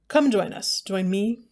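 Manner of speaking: ordinary talk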